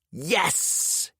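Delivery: put-on high, thrilled little boy's yes; excited child. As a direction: squeal it like a delighted kid. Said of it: "he says excitedly".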